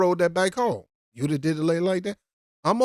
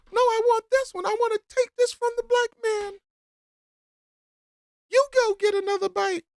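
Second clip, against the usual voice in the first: much higher, whiny Karen parody; mocking Karen. Commentator replays the bike woman as Karen, high whiny parody. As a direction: whine it like a spoiled woman grabbing the bike. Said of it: "let's try that again, Karen".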